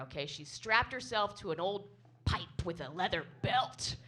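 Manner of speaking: ordinary delivery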